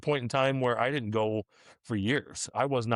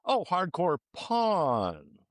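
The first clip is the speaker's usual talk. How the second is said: higher, very drawn-out "porn" as "pawn"; mock realization; 5 semitones higher, much slower than usual. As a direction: act out finally getting it, stretching the last word. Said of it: "Mandy's like".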